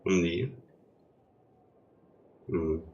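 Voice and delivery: usual speaking voice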